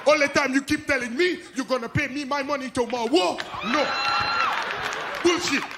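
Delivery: put-on loud Haitian-accented English rant; mock-furious Haitian landlord demanding rent; Haitian French accent. Haitian landlord yells at a tenant over late rent in a French accent. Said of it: "Cab drivers, landlords".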